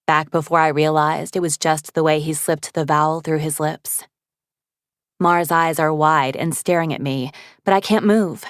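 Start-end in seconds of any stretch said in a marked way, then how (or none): none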